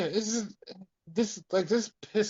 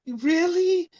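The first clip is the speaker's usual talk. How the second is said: much higher, hammed-up "Really?"; mock teary disbelief; 12 semitones higher than usual. Tatum answering Izzy's confession, high mock disbelief. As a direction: squeak it like a soap heroine.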